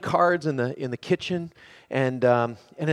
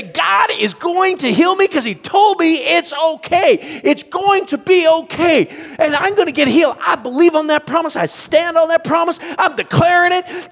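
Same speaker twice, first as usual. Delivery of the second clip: high, loud, exultant shouting; overjoyed, full-voice claiming of a promise; excited testifying; 13 semitones higher, 10 dB louder than usual. Acted. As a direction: whoop and declare it like a jubilant believer in church. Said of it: "we get all excited".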